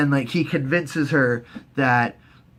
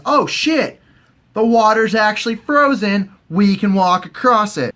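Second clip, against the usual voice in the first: excited realization; 6 semitones higher, 4 dB louder than usual. Retold or imitated. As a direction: act out a sudden, happy discovery.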